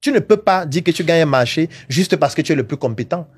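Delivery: ordinary delivery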